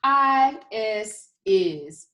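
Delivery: letters drawn out very slowly; slow spelling. Shown as a demonstration. Teacher modelling the spelling slowly for children. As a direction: spell each letter slowly, then the word. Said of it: "Let's spell it".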